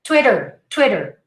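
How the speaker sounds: Twitter as TWIH-der; correct pronunciation. Shown as a demonstration. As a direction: say Twitter with short i and quick tap. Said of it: "Don't say Twitter".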